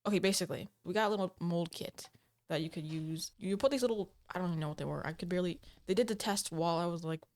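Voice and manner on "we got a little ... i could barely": ordinary delivery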